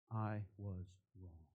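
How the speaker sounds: low, slow and soft; reluctant admission. Shown as a demonstration. Preacher owning up to the wrong direction, quiet admission. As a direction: admit it quietly, letting the pitch sink. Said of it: "very difficult words for all of us to say".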